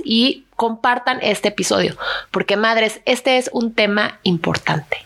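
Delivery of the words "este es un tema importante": dramatic hushed whisper; serious whisper. Acted. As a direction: whisper it like a big secret. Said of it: "mi introducción seria".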